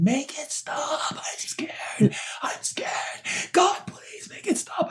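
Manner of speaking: high, thin, breathy cry of fear; whisper-cry it as if begging in terror; a frightened high plea, near tears; terrified whimper. Retold or imitated. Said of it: "I was screaming in my head".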